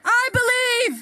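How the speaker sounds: shouted call for the crowd; rally speech. Real, not acted. Leads crowd call and response.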